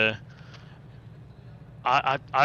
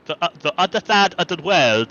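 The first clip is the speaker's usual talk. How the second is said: louder, mocking Aussie twang on "other side of the world"; teasing a co-host in an Aussie accent; 4 semitones higher, 6 dB louder than usual. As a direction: echo his words back in a teasing Aussie accent. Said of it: "I wasn't trying to do an Aussie accent".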